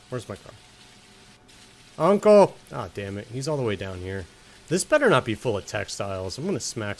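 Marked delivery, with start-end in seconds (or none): 2.0-2.5 loud call, higher. Real, not acted. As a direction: shout for Uncle across the map. Calling out to game uncle.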